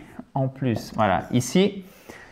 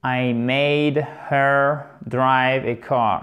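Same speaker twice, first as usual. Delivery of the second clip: slow, careful English demo; unhurried model reading; slow model sentence; 2 semitones lower, much slower than usual. Shown as a demonstration. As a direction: spell it out slowly for learners. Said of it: "la phrase en anglais".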